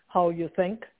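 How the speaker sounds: ordinary talk, nothing marked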